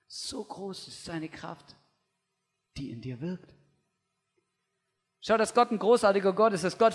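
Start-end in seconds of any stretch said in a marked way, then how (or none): 0.1-3.5 slow, soft and much quieter; hushed personal repeat. Shown as a demonstration. Preacher turns the verse to one listener, soft and slow. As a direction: lean in and say it softly to each listener.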